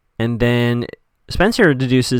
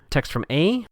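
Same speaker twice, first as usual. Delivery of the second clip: ordinary talk, nothing marked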